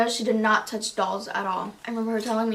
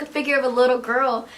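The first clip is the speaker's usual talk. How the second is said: usual speaking voice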